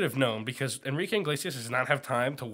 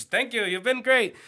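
mock pop star; 8 semitones higher, 3 dB louder than usual. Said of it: "And he goes".

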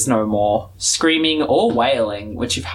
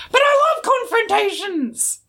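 much higher, gleeful eagerness; Old Smoky. Acted. Old Smoky objects to avoiding the fight, high gleeful. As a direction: squeal with delight at the idea of a fight.

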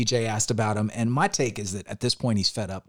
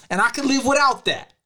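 insulting tone; 13 semitones higher, 3 dB louder than usual. Retold or imitated. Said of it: "It's his tone and the way he said it".

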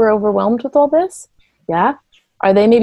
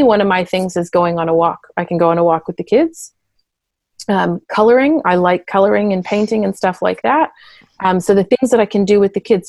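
ordinary delivery

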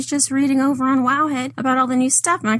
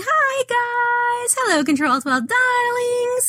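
excited greeting; 12 semitones higher, slower than usual. Real, not acted. Bubbly greeting to the listeners.

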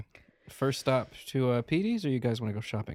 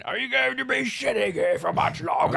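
high, loud mock old man; 5 dB louder than usual. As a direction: repeat it as a fussy old man. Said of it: "Old man talking to himself".

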